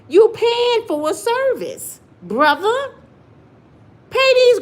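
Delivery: high, slow and loud rebuke; loud scolding. Real, not acted. Telling a brother he pays for a service, loud scolding. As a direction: drag it out to scold.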